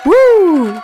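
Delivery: high, loud whoop; a happy little woo; excited cheer. Real, not acted. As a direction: cheer in real delight. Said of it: "celebrating first fic".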